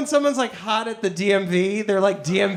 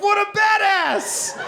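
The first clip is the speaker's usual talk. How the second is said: higher, slow, laughing admiration; laughing mock awe; awed; 12 semitones higher, slower than usual. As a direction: marvel at him. Narrator awed by friend.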